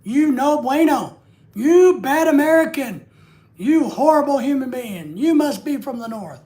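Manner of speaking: mock the outraged tea drinker, loud and indignant; mocking angry southern lady. Older woman scolding him for unsweet tea, mock loud outrage.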